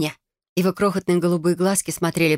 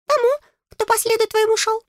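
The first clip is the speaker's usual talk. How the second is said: very high, piping boast; self-satisfied little fairy voice; proud fairy. A narrator's reading. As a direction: report it proudly in a squeak. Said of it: "гордо сообщил он".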